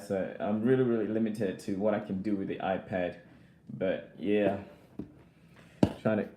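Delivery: normal talking voice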